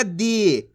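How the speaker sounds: दी with a long, held ee; teacher's drawn-out दी, falling at the end; stretched long ee. Shown as a demonstration. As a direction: draw out the vowel in दी. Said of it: "खींच के पढ़ा जाता है".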